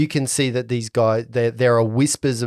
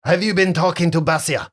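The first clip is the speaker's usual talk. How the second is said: put-on higher French-accented interrogation; half French accent; 5 semitones higher than usual. Captain questions who they talked to, French accent. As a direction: press them in a French accent. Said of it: "half French accent".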